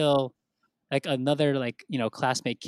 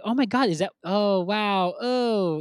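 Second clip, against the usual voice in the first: high, drawn-out pitying "oh"s; mock-appalled classmates; 7 semitones higher, slower than usual. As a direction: imitate a class cringing at someone's gift. Whole class reacting to the gift.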